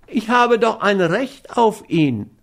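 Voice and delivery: high, pleading whine; a narrator's mild whining plea; whiny cry. A narrator's reading. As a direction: whimper out your claim like a wronged man. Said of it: "rief er weinerlich aus".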